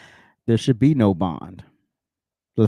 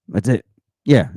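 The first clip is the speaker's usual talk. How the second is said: ordinary delivery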